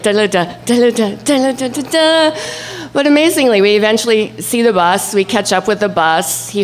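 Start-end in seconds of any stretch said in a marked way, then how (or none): 0.0-2.4 quick hummed da-da-da chase tune; cartoon chase music. Storyteller mimicking cartoon chase music in the car. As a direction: sing the cartoon chase theme. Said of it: "crazy cartoon chase music".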